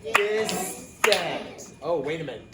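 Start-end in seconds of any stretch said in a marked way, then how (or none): none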